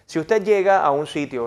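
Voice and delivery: normal talking voice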